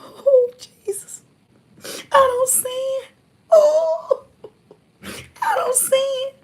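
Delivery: high, wailing mock sobs; mocking fake crying churchgoer. Mocking a churchgoer crying to the pastor, hammed-up wailing. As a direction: ham up a weeping sinner's plea. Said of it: "you going to the pastor talking about".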